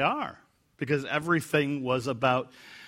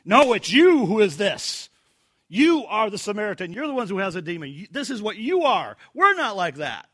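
finger-pointing accusation; 7 semitones higher, 7 dB louder than usual. Shown as a demonstration. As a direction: point the finger and throw it back. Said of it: "you can see the way they're saying".

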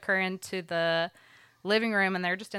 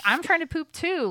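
higher and louder, mock irritated snap; exasperated retort; 11 semitones higher, 5 dB louder than usual. Mom tells daughter she is busy, retold in higher, louder exasperation. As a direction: play the annoyed mom who wants her own bathroom time. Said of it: "But at first, I'm like".

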